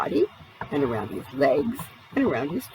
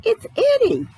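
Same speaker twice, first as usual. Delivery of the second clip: much higher and louder, almost crying out; pleading shout; 4 dB louder than usual. Acted. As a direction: beg the frightened children to recognise you. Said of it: "Eddie shouted".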